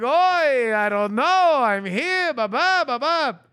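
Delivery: hammed-up weary Yiddish-style grumble; mock kvetching while lugging stuff; mock Yiddish schlep. Showing how schlepping should sound, mock Yiddish grumble. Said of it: "But schlepping would be more like".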